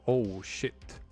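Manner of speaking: ordinary talk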